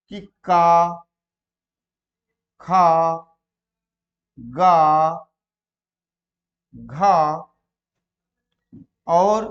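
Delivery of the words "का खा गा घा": each syllable loud, long and separate; letter demonstration. Shown as a demonstration. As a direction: sound out का, खा, गा, घा one at a time. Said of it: "हम वर्ण बोल रहे हैं".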